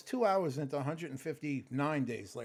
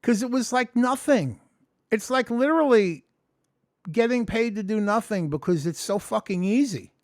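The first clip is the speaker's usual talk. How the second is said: loud, higher retelling to his supervisor; retold to supervisor; 6 semitones higher, 10 dB louder than usual. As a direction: belt it out like you're reliving it. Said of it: "I said".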